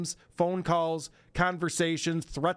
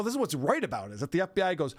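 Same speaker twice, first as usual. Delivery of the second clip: ordinary delivery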